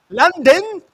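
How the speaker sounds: high, loud surprised question; verrast, hoog en luid. Real, not acted. Surprised reaction.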